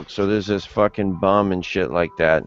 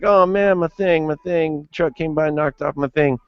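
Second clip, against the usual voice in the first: put-on high, panicked drunk whine; drunk bum; 8 semitones higher than usual. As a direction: squeal it like a drunk who lost something. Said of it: "And he's like".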